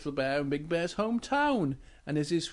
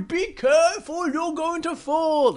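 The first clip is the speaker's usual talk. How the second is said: high, worried warning call; Big Bear; 11 semitones higher, 5 dB louder than usual. Acted. Big Bear warns Little Bear, high and worried. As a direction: warn urgently with raised voice. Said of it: "And Big Bear goes".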